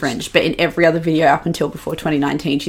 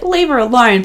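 higher, louder mock plea; raised-pitch, loud mock defending; mock protest; 7 semitones higher, 5 dB louder than usual. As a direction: imitate everyone leaping to her defence. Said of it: "everyone was like".

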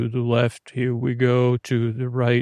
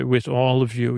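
ordinary delivery

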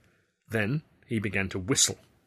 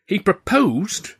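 slow, high, louder stunned echo; shocked; 11 semitones higher, 7 dB louder, much slower than usual. Acted. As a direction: repeat it in total disbelief.

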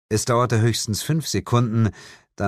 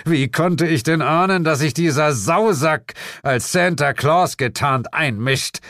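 higher, louder furious outburst; enraged; 8 semitones higher, 4 dB louder than usual. A narrator's reading. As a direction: rant in fury at the meddler. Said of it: "Sein Gesicht verzerrte sich vor Wut". (wütend schimpfen)

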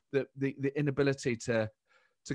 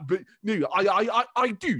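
loud, high stammering insistence; spluttering repeats rising in pitch; Boris; 6 semitones higher, 6 dB louder than usual. Retold or imitated. Impressionist showing various verbal tics, spluttering insistence. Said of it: "sort of verbal".

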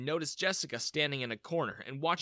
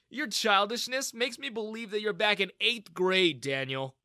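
raised, mildly mocking comeback; smirking; 6 semitones higher, 4 dB louder than usual. A narrator's reading. Young founder answering his bully, smirking. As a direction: needle him with a smirk. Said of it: "I smirked".

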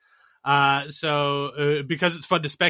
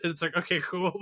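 normal talking voice

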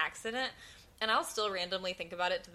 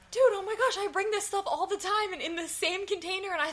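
higher, animated disbelief; excited retelling; 8 semitones higher than usual. Retold or imitated. Dave explaining the container mix-up, excited. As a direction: gush about the mix-up. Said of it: "He's like".

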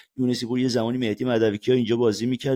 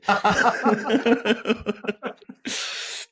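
a long, high real laugh that bursts out; real amused laughter; 12 semitones higher than usual. Real, not acted. Laughing at his own story.

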